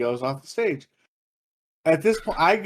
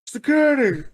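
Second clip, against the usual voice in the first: high, mock-alarmed announcer; 8 semitones higher than usual. DJ stopping the music to call bouncers, alarmed. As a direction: call for security like a startled DJ. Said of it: "D J literally over".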